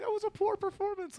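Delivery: very high squeal of mock reproach; mock high complaint. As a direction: squeak it like a put-on telling-off. Acts out imagined complaint.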